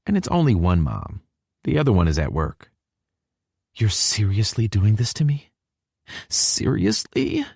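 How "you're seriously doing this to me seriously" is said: put-on high, rising girl's voice; girlish disbelief; incredulous girl. A narrator's reading. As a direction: end on a drawn-out, high, disbelieving question. Hallie protests meeting the mother.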